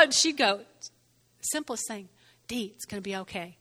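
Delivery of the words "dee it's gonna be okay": low, soft, quick reassurance; calm reassurance. Retold or imitated. Dear friend answering her distressed call, calm reassurance. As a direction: soothe quietly, imitating a steadying friend.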